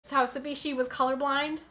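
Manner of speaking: normal talking voice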